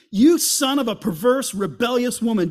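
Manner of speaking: loud, raging curse at his son; furious Saul. Acted. Saul cursing Jonathan at the feast, furious shout. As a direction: spit out the insult in fury.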